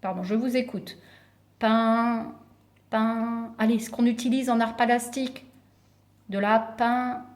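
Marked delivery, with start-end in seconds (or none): 1.6-3.5 "pain" held long, left hanging; sustained "pain", repeated after a pause; drawn-out syllable. Shown as a demonstration. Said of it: "il y a la syllabe". 6.4-7.2 "pein-" held and left open; unfinished first syllable. Shown as a demonstration. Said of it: "il y a la syllabe".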